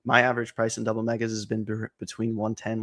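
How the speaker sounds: ordinary talk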